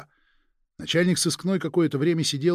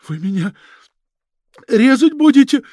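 high, strained, loud cry between coughs; 9 semitones higher, 10 dB louder than usual. Acted. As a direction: force the words out through coughing. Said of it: "натужно спрашивал он между приступами кашля". (натужно, между приступами кашля)

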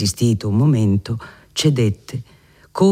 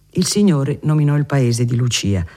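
plain reading voice

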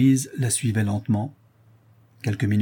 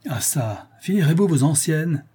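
rough, impatient demand, rising louder; brutal; 6 semitones higher, 3 dB louder than usual. A narrator's reading. Bailiff hurries the weeping prisoner, brutal impatience. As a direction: cut in brusquely, pitched up. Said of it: "dit brutalement Bourdin".

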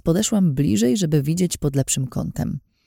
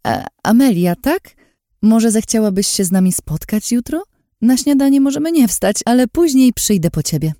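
higher, louder pushy invitation; insistent offer; 8 semitones higher, 5 dB louder than usual. Acted. Lin pressing the waitress into a meeting, higher and louder. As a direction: invite as if it were an order. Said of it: "tonem, który wcale nie wskazywał na pytanie".

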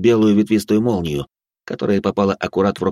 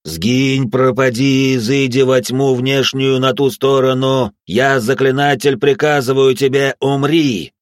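loud, slow banishing chant; commanding incantation; 5 semitones higher, 4 dB louder, slower than usual. Acted. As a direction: command the demon to die. Exorcist's banishing spell.

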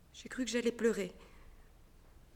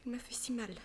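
whispered pained confession; 7 dB quieter than usual. Acted. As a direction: breathe it out, hurt. Consuelo confie sa douleur, effrayée. (chuchoté, blessée)

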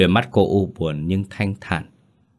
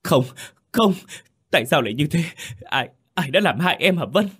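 a voice pitched high and strained with grief; anguished cry; 9 semitones higher than usual. A narrator's reading. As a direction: cry out in pain for his lost love. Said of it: "Trọng gào lên trong đau đớn".

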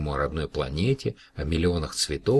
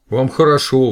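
high, very loud outburst; shouting out; 8 semitones higher, 12 dB louder than usual. A narrator's reading. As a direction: fling the words out. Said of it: "выкрикнула Маша".

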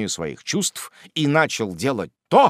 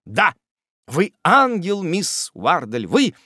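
much higher and louder, slow rapture; energetic tone; 9 semitones higher, 7 dB louder, much slower than usual. A narrator's reading. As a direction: pour it on with fervour. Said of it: "повторил энергичным тоном".

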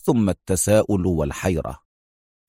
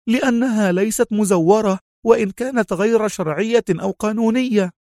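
high-pitched, worked-up woman's voice; agitated; 12 semitones higher than usual. A narrator's reading. Mona warning the consul about banknotes, agitated. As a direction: press the point urgently, high in pitch. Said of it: "صاحت منفعلة".

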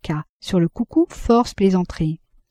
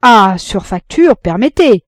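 retort; 3 semitones higher, 8 dB louder than usual. A narrator's reading. Arnoux retorts to the jibe.

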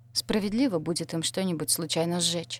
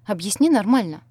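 louder, exasperated demand; getting angry; 3 semitones higher, 8 dB louder than usual. A narrator's reading. Friend demands an explanation from the crying heroine, getting angry. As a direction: push for a straight answer, voice raised. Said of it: "начала злиться Шантия".

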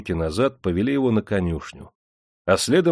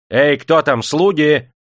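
loud, high summons; imperious raised call; 6 semitones higher, 7 dB louder, slower than usual. A narrator's reading. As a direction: holler for the servants. Master calls out for servants.

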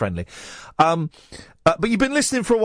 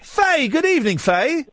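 sing-song greeting; 8 semitones higher than usual. Acted.

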